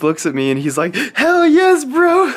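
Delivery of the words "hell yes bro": very high, very loud pumped-up shout; hyped gym bro. Retold or imitated. Friend shouting back with headphones on, pumped gym-bro yell. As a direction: bellow it like a hyped lifter mid-set.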